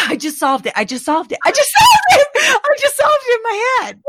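giddy, escalating outburst, pitch shooting up; excited breakthrough. Real, not acted. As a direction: build from laughing to a high, loud squeal. Sudden excited breakthrough, yelled repeatedly.

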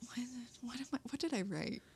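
whispered muttering to herself; a quick unvoiced aside under the breath; whispering. Real, not acted. Muttering to herself mid-thought.

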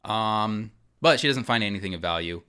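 ordinary talk

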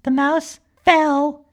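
plain reading voice

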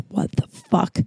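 soft, whispery stunned curse; stunned disbelief. Retold or imitated. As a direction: breathe it out in hushed disbelief. Stunned on finding table outside.

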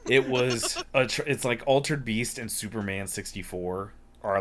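ordinary talk, nothing marked

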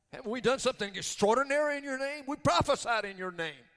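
high, loud indignant challenge; belligerent. Acted. The many defend their works to Jesus, loudly belligerent. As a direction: protest it loudly as a rhetorical question. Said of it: "they're in essence being belligerent".

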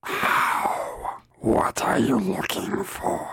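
rasping stage whisper; menacing witch. Acted. Witch challenges the visitors, menacing whisper. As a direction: question them in a creepy hoarse whisper. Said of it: "that is menacing".

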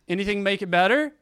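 a doctor cheerfully coaxing a mute patient, upbeat; bright coaxing doctor. Retold or imitated. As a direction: cheerfully coax a bored patient.

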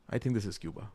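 usual speaking voice

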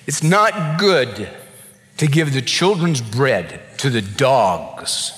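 low, slow measured Jesus; Jesus testing the mother, low and slow; Jesus' stern reply. Acted.

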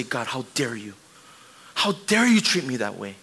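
mock indignation. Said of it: "we say".

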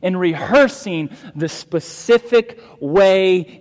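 loud, high, slow preaching emphasis; forceful preaching. Acted. Pastor expounding the psalm to the congregation, forceful preaching. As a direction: preach it out, drawing out each key word.

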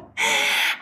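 quick airy giggle. Real, not acted. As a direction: let out a little breathy laugh. Laughs after gushing about partner.

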